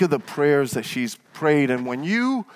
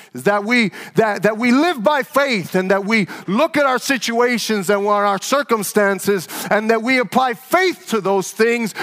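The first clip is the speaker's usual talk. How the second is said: higher and louder, building to a climax; full-force preaching; 8 semitones higher, 4 dB louder than usual. Acted. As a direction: drive it home like a preacher in full flight.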